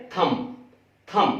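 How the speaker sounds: थम said THUM, no B at the end; correct pronunciation thumb. Shown as a demonstration. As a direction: close थम on the M, B silent. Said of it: "बी silent है". (थम, बी silent)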